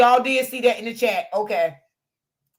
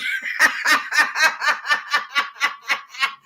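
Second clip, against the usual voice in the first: long wheezing laugh; breathless laugh. Real, not acted. Still laughing at the lady's insult on the live. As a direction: let the laugh roll out.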